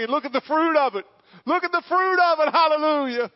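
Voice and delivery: loud, high preaching climax; full-force pulpit shout; fiery preaching. Acted. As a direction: lift the crowd, preaching hard.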